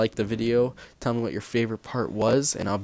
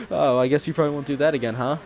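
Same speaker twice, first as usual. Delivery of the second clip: lightly imitated amused mom, pitched up; higher, mildly mocking parent voice; amused mother; 5 semitones higher, 3 dB louder than usual. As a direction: tease as if it's no big deal. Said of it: "like, laughing about it".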